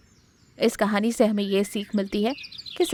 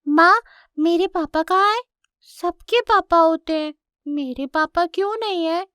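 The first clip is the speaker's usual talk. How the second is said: put-on high, louder child cub voice; little lion cub, wondering; 8 semitones higher, 4 dB louder than usual. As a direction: ask Ma like a puzzled little cub. (नन्हे शेर के बच्चे की ऊँची, मासूम आवाज़)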